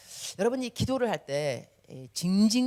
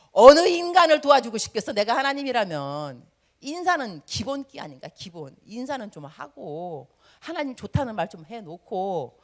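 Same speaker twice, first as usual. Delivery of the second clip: ordinary talk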